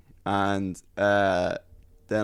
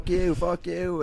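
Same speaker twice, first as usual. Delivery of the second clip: high-pitched, drawn-out mock shout; crowd jeering; 10 semitones higher, slower than usual. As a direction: imitate a crowd cursing someone out. Crowd shouting at ejected hecklers.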